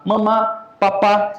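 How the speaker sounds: leve imitação de neném balbuciando, mais agudo; imitando um bebê. Retold or imitated. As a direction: diga como um bebê aprendendo a falar. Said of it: "o neném começa a falar". (professor imita o neném começando a falar, voz de bebê leve)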